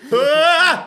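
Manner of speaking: high, loud startled shriek; little scream at the end. Shown as a demonstration. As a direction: yelp when someone jumps out. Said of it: "a wee thing at the end".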